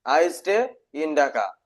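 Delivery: slow model sentence. Shown as a demonstration. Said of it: "teacher models sentence".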